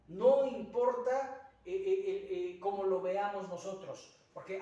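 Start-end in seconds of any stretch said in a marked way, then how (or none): none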